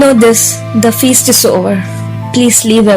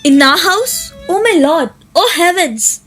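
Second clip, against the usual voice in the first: feigned shock; 3 semitones higher than usual. Acted. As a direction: fake the shock for show.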